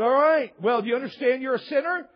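high, brisk, loud mock script; pushy evangelist. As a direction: rush the acted question along eagerly. Recruiter retold rattling through script.

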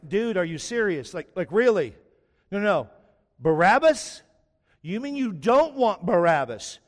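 can't believe what he's hearing; incredulous Pilate. Acted. Pilate asking the crowd in disbelief.

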